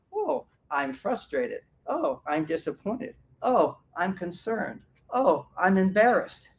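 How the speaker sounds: soft, slow, each feeling named calmly; name each feeling gently, without drama; gently naming feelings. Shown as a demonstration. To show a calmer way, softly naming each feeling. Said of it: "as opposed to".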